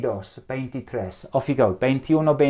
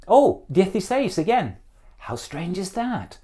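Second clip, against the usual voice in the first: mock surprise; 6 semitones higher than usual. Mock surprise at repeated answer.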